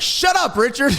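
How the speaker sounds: high mock yell of a movie line; imitating a shout. Imitating the character yelling at Richard. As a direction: bark it like the angry character. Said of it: "he's like".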